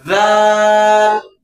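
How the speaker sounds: رال slow with the tongue tip between the teeth; drawn-out zaal. Shown as a demonstration. Teacher models رال with the tongue between the teeth. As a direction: hold رال like سا. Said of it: "اس طریقے سے".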